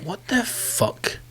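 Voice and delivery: slow, pitched high, held-back disbelief; mock disbelief. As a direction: drag it out in disbelief. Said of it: "you think".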